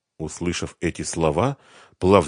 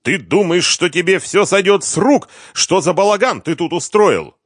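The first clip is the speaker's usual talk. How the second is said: higher, louder acted shout; acted angry shouting; 12 semitones higher, 8 dB louder than usual. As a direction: shout him down in mock fury. Furious uncle confronting nephew.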